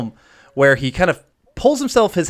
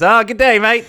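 bright, louder mock Australian greeting; mock Australian accent; 8 semitones higher, 4 dB louder than usual. Host imitating Lloyd greeting the Austrian woman, mock Australian accent. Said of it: "He thinks it's Australian".

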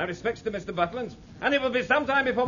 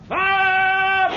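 high loud bellow of fire; shouted command; 12 semitones higher, 8 dB louder than usual. Acted. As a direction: yell the firing order. Ordering guns to fire.

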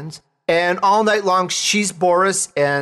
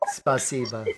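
"Spasiba" said again, much softer; softer repeat; 3 semitones lower, 8 dB quieter than usual. Shown as a demonstration. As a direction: echo "Spasiba" quietly to yourself. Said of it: "That's thank you in Ukrainian".

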